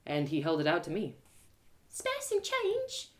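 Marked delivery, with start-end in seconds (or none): 1.9-3.1 put-on much higher, slow, soft child plea; a small hungry boy begging. Beggar boy holds out his bowl, soft slow plea.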